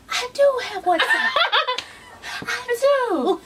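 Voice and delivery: high, laughing eager answer; giddy reply. Retold or imitated. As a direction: act out a flattered yes through laughter. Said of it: "And I was like".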